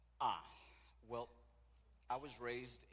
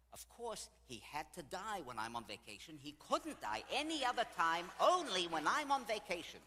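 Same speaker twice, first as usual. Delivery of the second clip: loud, high-pitched grumbling that builds; indignant complaint; 9 semitones higher, 10 dB louder than usual. Retold or imitated. Widow griping that he died during her vacation, indignant. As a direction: act out an exasperated widow, building louder.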